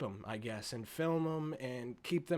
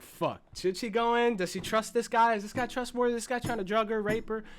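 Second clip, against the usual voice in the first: higher, louder rapid worried questions; anxious viewer thoughts; 6 semitones higher, 4 dB louder than usual. Retold or imitated. Viewer fearing for Tess, higher and louder. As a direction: fire off worried questions, rising alarm. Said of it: "You're like".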